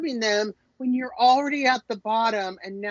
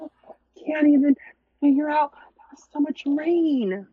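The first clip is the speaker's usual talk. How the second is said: dazed mock lament; 5 semitones higher, 6 dB louder than usual. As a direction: moan it like a dazed castaway. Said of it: "I'm like".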